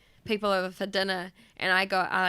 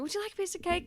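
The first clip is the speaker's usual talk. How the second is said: high-pitched polite offer; lightly voiced host offering food; imitated polite host; 9 semitones higher, 5 dB quieter, much faster than usual. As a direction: offer it high and a little soft. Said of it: "Irish people and they're both going".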